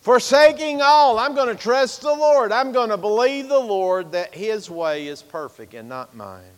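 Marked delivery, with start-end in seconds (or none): 0.0-5.1 loud, much higher cry on "Forsaking all"; loud preaching cry, far higher than before; shouted declaration. Real, not acted. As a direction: lift it to a ringing shout. Repeat of acrostic, shouted.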